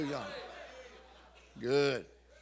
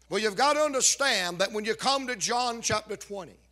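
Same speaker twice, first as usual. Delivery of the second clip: usual speaking voice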